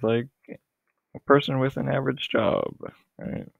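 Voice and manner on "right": ordinary talk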